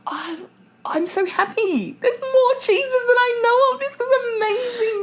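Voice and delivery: high, tearful-sounding gush of joy; mock overjoyed. Co-host gushing over unknown cheeses, hammed-up joy.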